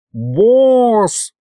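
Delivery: high, drawn-out holler; Aristophanes yelling. A narrator's reading. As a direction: call out for the boss across a crowd. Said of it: "заорал Аристофан".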